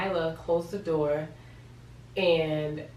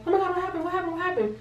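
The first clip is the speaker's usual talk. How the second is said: high-pitched panicky mom impression; dramatic worried mom; 13 semitones higher, much faster than usual. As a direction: fire off frantic repeated questions, imitating an anxious mother. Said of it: "she's very dramatic. She's worrisome".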